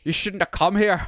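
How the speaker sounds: lightly imitated Scottish accent; a hint of a Scottish brogue; bad Scottish accent. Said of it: "like a bad Scottish accent".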